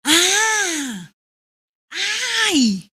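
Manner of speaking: high sharp cry then a short ay; pained cry. Shown as a demonstration. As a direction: cry out as if hurt. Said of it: "Cuando te dice".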